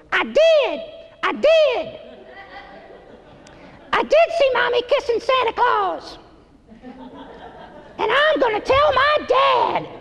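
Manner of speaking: put-on very high little-boy singing voice. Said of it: "I don't do a very good [name] impression".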